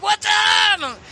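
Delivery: big high shouted greeting; excited shout. Retold or imitated. East Coast crowd greeting the podcast host, shouting. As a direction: yell it like fans spotting a celebrity.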